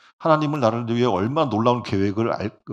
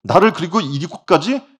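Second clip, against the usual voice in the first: grateful realization; 5 semitones higher, 8 dB louder than usual. Retold or imitated. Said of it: "하나님의 은혜를 생각할 때 감격이 넘치는".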